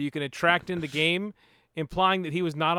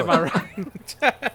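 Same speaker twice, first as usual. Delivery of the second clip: laughing; much slower than usual. Real, not acted. Laughing through his own joke.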